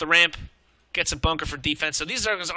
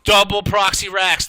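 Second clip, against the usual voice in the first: surprised; 3 semitones higher, 7 dB louder than usual. Real, not acted. Shock at surprise proxy play.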